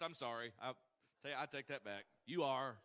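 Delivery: usual speaking voice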